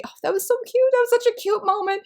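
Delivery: high, delighted squeal of a memory; excited gush. Real, not acted. Reader gushing to viewers about a cute chapter, excited and high.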